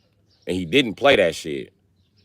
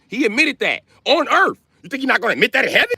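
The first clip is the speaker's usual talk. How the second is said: fast excited preaching; worked-up rant; 14 semitones higher than usual. Real, not acted. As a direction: pitch shoots up, rattled off fast. Ranting higher and faster.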